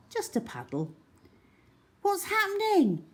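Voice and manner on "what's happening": put-on much higher worried girl's call; girl calling anxiously from the path; Cherry calling. A narrator's reading. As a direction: call from a distance. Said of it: "he heard Cherry from the path".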